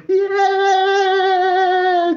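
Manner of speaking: long, high squeal; child's joyful squeal. Retold or imitated. Boys thanking God, long high squeal. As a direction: imitate a kid's drawn-out cry of joy. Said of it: "igual coisa de menino".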